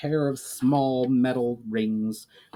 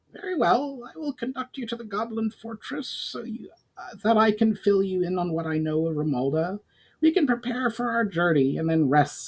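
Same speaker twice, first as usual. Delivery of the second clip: higher, pinched goblin speaking gratefully; higher goblin voice, earnest; 9 semitones higher than usual. A narrator's reading. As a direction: pitch it up like a goblin emissary offering help. Goblin makes plans.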